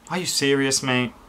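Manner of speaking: ordinary delivery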